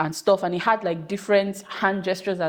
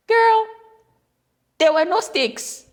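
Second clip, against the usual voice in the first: incredulous; 12 semitones higher, 5 dB louder, slower than usual. Real, not acted. Laughing disbelief at childhood seriousness.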